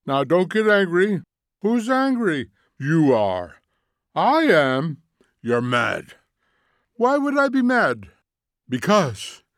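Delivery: loud back-and-forth squabble, pitch jumping; acted bickering. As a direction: trade testy retorts, questions pitched high. Acted quarrel on the screen.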